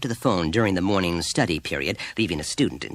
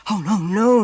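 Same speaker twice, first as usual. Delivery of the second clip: high, loud hurried noes; flustered denial; 14 semitones higher, 7 dB louder than usual. A narrator's reading. Teacher placating the chilly mother, flustered denial. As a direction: backpedal at once. Teacher denies implying neurosis.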